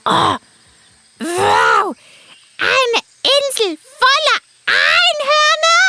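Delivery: high, slow squawky bird voice; a chirpy talking parrot. Acted. Parrot character voice.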